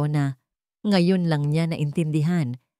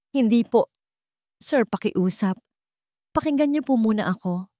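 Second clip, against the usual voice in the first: higher-pitched begging; pleading; 5 semitones higher than usual. A narrator's reading. Lyra begs Ricardo to listen, pleading. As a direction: beg to be heard. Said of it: "Pagmamakaawa ni Lyra".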